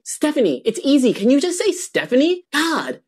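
high-pitched, acted exasperation; exasperated teacher. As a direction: plead with a pupil, voice pitched right up. Teacher exasperated at repeated mispronunciation.

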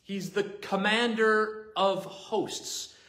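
high, slow preaching voice; slow solemn proclamation. Acted. Preacher proclaiming God's title to the congregation, slow and solemn. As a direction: proclaim each word to the congregation.

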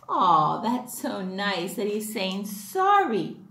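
ordinary delivery

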